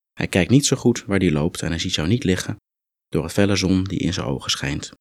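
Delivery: ordinary talk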